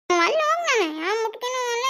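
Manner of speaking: put-on high tearful child's whine; petulant child wailing in a high voice; tearful whining. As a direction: refuse through whiny crying. Child tearfully refuses offered food.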